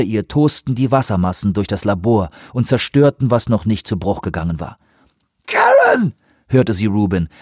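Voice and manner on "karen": very high, desperate scream of a name; desperate cry. A narrator's reading. Rubin calls Weaver as the water rushes in, desperate cry. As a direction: cry out in panic.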